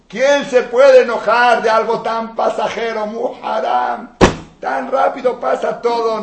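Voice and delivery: full-force sermon climax; fiery preaching. Acted. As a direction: thunder at the congregation.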